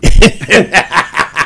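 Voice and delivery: short real laugh at his own story; amused laugh. Real, not acted. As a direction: laugh at the memory.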